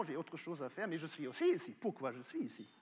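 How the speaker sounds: ordinary talk, nothing marked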